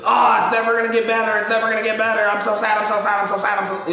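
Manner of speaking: exaggerated self-pitying looping lament; mock despair. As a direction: wallow in sadness on one high, unbroken note. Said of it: "dwell on it and just, you know".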